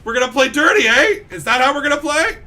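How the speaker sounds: high, shouted challenge; loud mock indignation. Gamer accusing his opponent of playing dirty, mock indignation. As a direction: throw it out as a loud dare.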